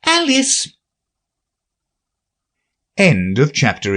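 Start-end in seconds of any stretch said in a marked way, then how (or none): 0.0-0.7 much higher, thin call; shrill little voice. Acted. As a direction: read out the name at the top of the voice. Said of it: "at the top of his shrill little voice".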